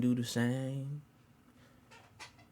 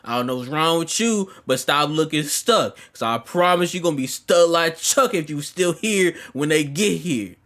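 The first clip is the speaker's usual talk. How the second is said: loud, raised, urgent commands; shouted orders; 5 semitones higher, 6 dB louder than usual. Retold or imitated. Ordering lingering guests out before parents arrive, loud and commanding. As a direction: drive them out with forceful orders. Acted ordering everyone out of house.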